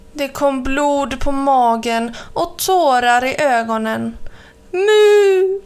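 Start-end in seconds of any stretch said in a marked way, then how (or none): 4.7-5.6 high, loud anguished moo call; crying moo. A narrator's reading. Hurt cow calling for help, loud moo. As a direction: cry out in pain like a hurt cow. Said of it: "ropade hon".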